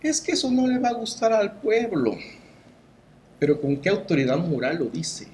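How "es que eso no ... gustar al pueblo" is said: mocking official voice. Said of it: "se atreve a decir".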